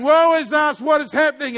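loud mock panicked wail. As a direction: cry it out like people going under. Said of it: "crying and screaming and saying".

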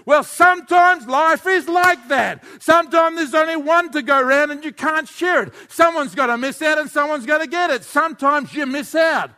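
high, loud, emphatic preaching; exasperated pulpit shout; shouted retort. Acted. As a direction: shout the hard truth over the whining. Preacher answers the complaining child.

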